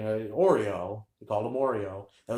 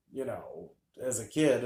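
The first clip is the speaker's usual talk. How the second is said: plain reading voice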